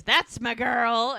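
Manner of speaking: loud, drawn-out retold praise; slow, boosted mock cheer; cheering her on. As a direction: imitate a proud shout of encouragement.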